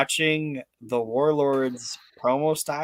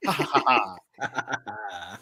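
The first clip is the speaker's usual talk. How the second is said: hammed-up evil laugh; villain laugh; 3 semitones lower, much slower than usual. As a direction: end with a cartoon villain cackle. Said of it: "and then going".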